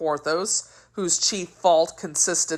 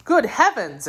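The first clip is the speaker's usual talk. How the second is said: higher, mildly astonished cry; a storyteller's startled outburst; astonished exclamation; 7 semitones higher than usual. A narrator's reading. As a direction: exclaim it in lightly acted amazement. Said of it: "exclaimed Porthos".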